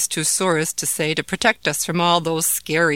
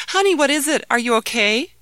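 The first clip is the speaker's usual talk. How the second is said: worried mother; 8 semitones higher, 5 dB louder than usual. Acted.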